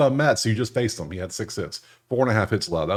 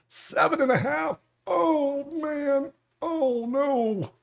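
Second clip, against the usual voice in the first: very high, wailing disappointment; high-pitched dismay; much slower than usual. Real, not acted. Bettor seeing the dreaded strikeout line, high dismayed wail. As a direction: squeal it high, as if crushed.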